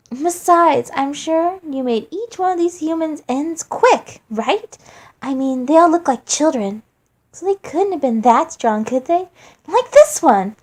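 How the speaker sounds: put-on high, loud, sugary childish taunting; mock sweet taunt. Flowey taunts his weeping father with fake sweetness. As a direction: needle him in a fake-innocent sing-song voice.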